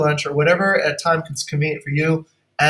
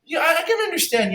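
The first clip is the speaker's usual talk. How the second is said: hammed-up manager; 10 semitones higher, faster than usual. Acting calm manager in role-play.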